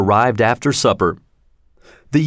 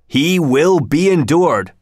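slow, higher-pitched, insistent command; commanding old uncle, drawn out; stern order; 5 semitones higher, slower than usual. A narrator's reading. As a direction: lay down the order firmly, word by word. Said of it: "ordered old Capulet".

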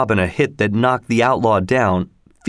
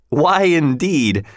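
ordinary delivery

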